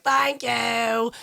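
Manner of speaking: mock working-class British accent; exaggerated working-class British thanks. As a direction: imitate a lowbrow London singer's accent. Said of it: "she has a working class accent".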